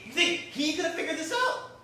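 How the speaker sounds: ordinary talk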